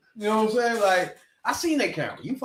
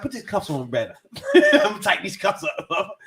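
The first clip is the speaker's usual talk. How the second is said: normal talking voice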